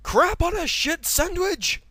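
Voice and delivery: very high, loud, slow curse; shouted curse. Acted. Henry realizing no one will help, shouted curse. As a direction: howl the curse.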